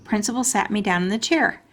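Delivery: ordinary delivery, nothing marked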